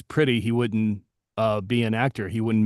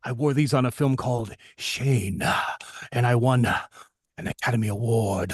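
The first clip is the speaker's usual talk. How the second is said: breathy rasp, phrases split by long exhales; halting raspy delivery, breath after every phrase; Jack Palance impression. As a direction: imitate an old tough-guy star, savoring each phrase. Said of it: "And he goes".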